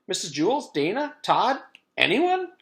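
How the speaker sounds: rising high loud calls; calling out. A narrator's reading. Jenny calling for her missing classmates, high and loud.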